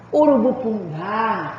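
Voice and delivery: "urubucungá" slowly, ending on a long "gá"; stress on final syllable. Shown as a demonstration. Teacher giving a longer oxytone example, drawn-out "gá" in "urubucungá". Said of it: "sílaba tônica está na última".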